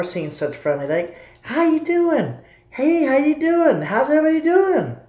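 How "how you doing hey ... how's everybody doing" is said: high, sing-song Southern greeting; put-on Southern accent. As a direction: chirp a Southern hello, way up high. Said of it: "I put an accent on for them".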